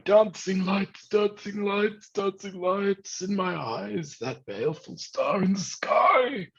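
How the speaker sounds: high, slow, eerie chant; raving captain. Acted. Blind captain raving to the crew, high slow chant. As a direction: chant it like a man half mad. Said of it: "And he keeps going".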